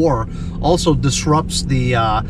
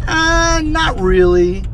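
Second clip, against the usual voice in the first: dismissive; 13 semitones higher, 4 dB louder, much slower than usual. Retold or imitated. Voices attendant's dismissive reply.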